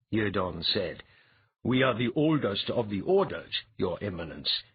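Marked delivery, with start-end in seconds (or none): none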